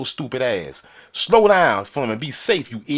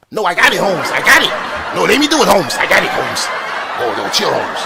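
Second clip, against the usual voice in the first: mock eager worker; 4 semitones higher, 8 dB louder than usual. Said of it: "they'll try to do".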